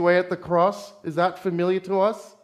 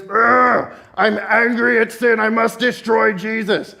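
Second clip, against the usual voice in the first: hammed-up wrathful God, higher and louder; mock angry God; 4 semitones higher, 4 dB louder than usual. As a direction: play the furious God you reject. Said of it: "a God who's so angry and goes".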